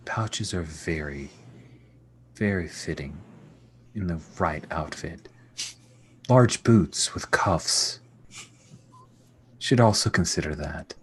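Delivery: low, soft, regal musing; measured queen mother. Acted. Queen advising her son on his outfit, low soft measured voice.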